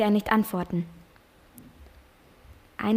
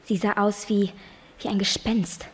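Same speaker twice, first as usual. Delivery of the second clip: ordinary talk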